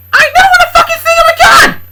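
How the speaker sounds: high, full-throated furious scream; furious shout. Acted. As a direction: scream it at the top of your lungs. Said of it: "After Kendra shouted".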